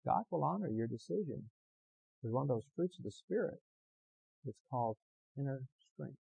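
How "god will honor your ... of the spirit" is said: ordinary talk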